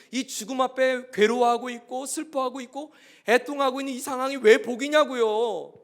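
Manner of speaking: crying out why mourning is a blessing; anguished cry to God. Retold or imitated. Pastor retells his prayer to God.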